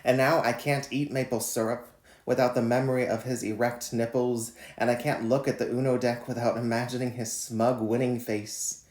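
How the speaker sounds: measured, deliberate poem reading; poetry reading. Acted. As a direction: read each line like a spoken poem. Reads his poem Quarantine Lover.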